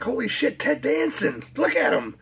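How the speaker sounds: high, excited retelling of spotting a star; excited exclamation. Retold or imitated. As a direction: replay the thrill of seeing Ted Danson onscreen. Retold excited reaction to movie.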